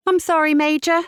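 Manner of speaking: high, lilting sing-song. A narrator's reading. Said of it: "Julia replied in a sing-song voice".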